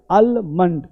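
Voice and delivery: आलमंड with a sounded L, slowly; wrong pronunciation. Shown as a demonstration. Teacher shows learners the error, mispronounced. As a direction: say आलमंड as "AL-mund". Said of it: "ये गलत है".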